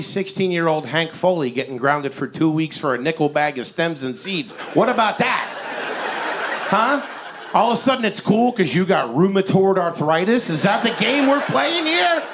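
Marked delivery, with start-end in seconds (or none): 4.7-12.2 rising indignant rant ending in a yell; mock outrage. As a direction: build from a jab to an outraged shout. Comic rant building to shout.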